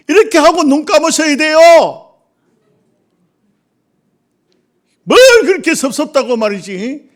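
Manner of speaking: full-force preaching. Acted. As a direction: preach it out hard to the congregation. (힘차게 외치는 설교)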